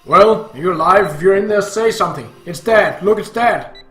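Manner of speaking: furious father demanding an answer; angry shouting. Acted. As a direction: shout at someone who won't respond. Father shouting at unresponsive child.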